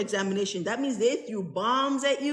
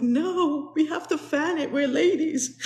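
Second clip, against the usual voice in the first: higher, laughing prim-lady voice; hammed-up ladylike protest; mock prim lady; 7 semitones higher, slower than usual. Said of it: "I'm like".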